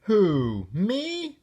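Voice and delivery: squeaky, high "Who, me?" in fake innocence; mock surprise. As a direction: play innocent, voice jumping up on "me". Froggy feigns innocence.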